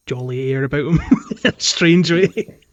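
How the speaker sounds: ordinary delivery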